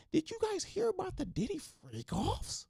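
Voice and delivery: very high mock-gossip question, stretching "freak offs"; hammed-up falsetto shock on "freak offs"; scandalized over-emphasis. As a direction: imitate a breathless scandalmonger hitting "freak offs". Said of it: "put all the emphasis on it".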